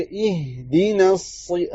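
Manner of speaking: اہدنا الصراط slowly with a thin noon into the saad; thin noon joined. Shown as a demonstration. Teacher showing the right join, slowly. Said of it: "کیسے".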